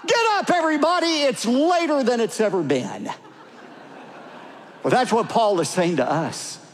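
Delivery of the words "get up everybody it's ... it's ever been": put-on high-pitched excited boy's yell; imitated yelling. Boy waking his family after the clock malfunctioned, yelling. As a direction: yell it like a boy rousing the house. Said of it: "ran through the house yelling".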